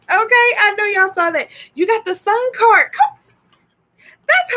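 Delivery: high, emphatic delight; high-voiced, can't-believe-it excitement; excited repeat. Real, not acted. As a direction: pitch it way up, thrilled at what just turned up. Repeats it, thrilled by the card.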